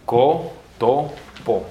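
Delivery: COTOPO very slowly, co, to, po separated; syllable by syllable. Shown as a demonstration. Teacher saying it in Esperanto, very slow cotopo. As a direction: break cotopo into three long syllables. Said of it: "En esperanto se dice".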